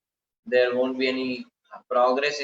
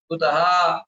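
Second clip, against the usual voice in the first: surprised kutaha; 3 semitones higher, 5 dB louder than usual. Shown as a demonstration. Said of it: "expressing his surprise by the word kutaha".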